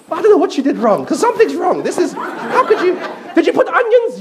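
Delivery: high, whiny voice of a picky husband; complaining husband. Retold or imitated. Uncle complains to his new wife about her cooking, high and whiny. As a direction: pitch it up and grumble about the food.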